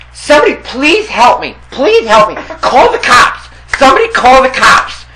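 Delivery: high, loud panicked complaining; frantic neighbor. Retold or imitated. Beaten Russian neighbor showing his lip, high and frantic. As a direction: whine it high and loud like he's hurt.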